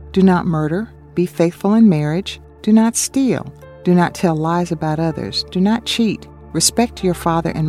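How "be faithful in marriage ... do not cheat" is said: normal talking voice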